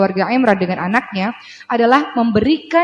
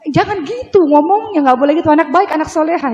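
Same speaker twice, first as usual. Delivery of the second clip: correcting father; 5 semitones higher than usual. Retold or imitated. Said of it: "saya bilang".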